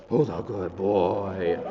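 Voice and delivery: put-on loud, drawn-out doggy baby talk; special dog voice. Shown as a demonstration. Owner talking to a beloved dog, in a special dog voice. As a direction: coo loudly at a beloved dog, slowly. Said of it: "put on a special dog voice".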